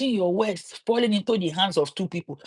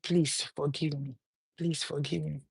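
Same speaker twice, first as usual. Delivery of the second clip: acted pleading; 4 semitones lower, 8 dB quieter than usual. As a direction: plead quietly for forgiveness. Man begs the woman.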